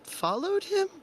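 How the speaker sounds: slow, incredulous female voice climbing high; woman clerk. Acted. Clerk questioning a follower of Tyr, slowly rising in disbelief.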